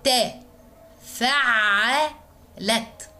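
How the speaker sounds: فعلت stretched out very slowly, each sound held; slow spelled-out weight. Shown as a demonstration. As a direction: spell out فعلت syllable by syllable for the class. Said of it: "هنقول التشكيل". (نطق بطيء جدًا لكلمة فعلت)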